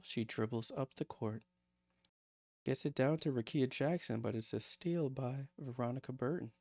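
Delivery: hushed, breathy-soft play-by-play mimicking the calm coach; soft-spoken coach impression. Joking to viewers, copying the quiet coach's courtside voice, hushed mock play-by-play. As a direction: murmur the call like a whispering ASMR coach. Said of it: "I'm gonna talk like that for a, a session".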